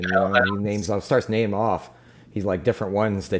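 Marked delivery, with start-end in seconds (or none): none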